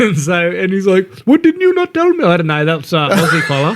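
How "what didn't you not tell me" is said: much higher, mumbled through a lollipop; mock the driver talking round a lollipop; lollipop in mouth. Taxi driver complaining about the ditch, garbled through a lollipop. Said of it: "a lollipop in his mouth".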